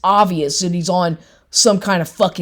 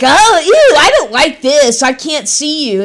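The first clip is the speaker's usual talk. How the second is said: high whiny mock of the boyfriend, much higher and louder at first; 6 semitones higher, 4 dB louder than usual. Woman mocks the visiting boyfriend's complaints, high whiny voice. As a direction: whine it like a fussy man.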